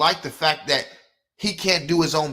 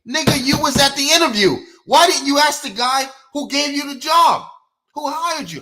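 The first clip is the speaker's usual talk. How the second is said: much higher and louder, incredulous scold; scolding yell; 10 semitones higher, 6 dB louder than usual. Real, not acted. Lecturing viewers about job pay, loud incredulous scolding. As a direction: berate them for not asking at the interview. Scolding workers who never ask.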